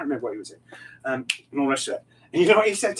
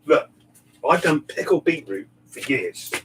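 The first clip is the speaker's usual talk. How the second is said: usual speaking voice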